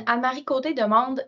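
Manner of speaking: ordinary delivery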